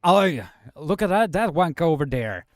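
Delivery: playful fake Scottish jab; lightly put-on Scottish voice; mock Scottish accent. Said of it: "I'll teach you some Scottish".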